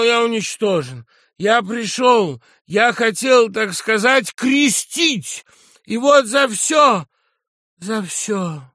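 high, loud, broken-up lament; anguished shouting. A narrator's reading. General declares himself destroyed, anguished shouting. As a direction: cry it out in pieces, easing on the last words. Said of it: "кричал генерал".

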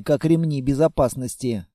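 plain reading voice

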